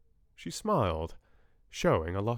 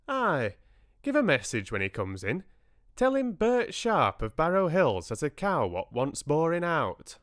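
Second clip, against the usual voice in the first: higher-pitched country dialect voice; broad rural speech, pitched up; rustic farmer; 7 semitones higher, 3 dB louder than usual. A narrator's reading. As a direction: leave a message like a hearty farmer. Farmer Bert Sharp gives his message.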